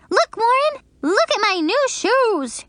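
high excited call across the playground; yelling. Acted. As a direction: shout to get his attention. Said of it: "she yelled".

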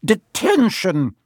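high, drawn-out, outraged cry; McGonagall. A narrator's reading. Professor catching Malfoy at night, shouting. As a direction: pronounce the punishment in outrage. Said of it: "she shouted".

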